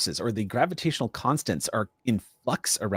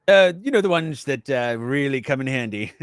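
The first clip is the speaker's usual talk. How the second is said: Doctor Sprack; 3 semitones higher, 4 dB louder than usual. Acted. Doctor bluffing about his expertise, pompous character voice. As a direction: bluff through it and end on a chuckle.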